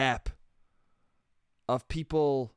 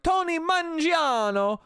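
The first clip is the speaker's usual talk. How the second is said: exaggerated Italian accent, much higher, louder and slow; mock Italian "Tony Mangiano", the vowels drawn out; hammed-up Italian accent, up high and slow; crazy Italian accent; 13 semitones higher, 4 dB louder, slower than usual. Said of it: "saying his name in a crazy Italian accent".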